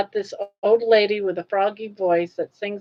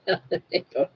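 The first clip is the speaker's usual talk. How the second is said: laughing; 5 dB quieter than usual. Real, not acted. Laughing at bullying joke.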